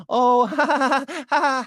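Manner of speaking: mock a woman laughing it off; nervous fake giggle. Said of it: "If you're like".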